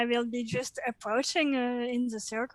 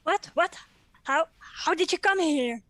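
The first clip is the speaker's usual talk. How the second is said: higher, louder startled questions; acted surprise; 5 semitones higher, 5 dB louder than usual. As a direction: play startled by unexpected company. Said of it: "really surprised".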